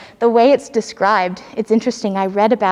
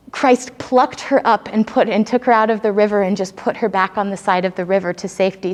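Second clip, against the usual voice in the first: ordinary talk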